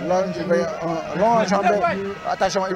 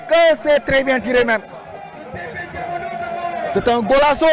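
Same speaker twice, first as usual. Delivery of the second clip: excited sports commentary; 8 semitones higher than usual. Real, not acted. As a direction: shout the goal with joy. Commentator calls a goal.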